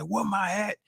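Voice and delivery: imitating a dad yelling; mild mock yell of a furious dad; retold yell. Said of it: "he's yelling at the top of his lungs".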